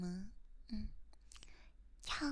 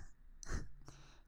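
soft giggle; 4 dB quieter than usual. Real, not acted. Giggles between curses.

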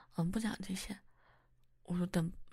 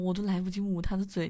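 ordinary delivery

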